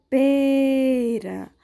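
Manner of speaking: PERA with a long, closed ê; closed vowel demo. Shown as a demonstration. Teacher gives pupils a circumflex example, drawn-out closed PERA. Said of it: "Quando eu fecho mais a boca".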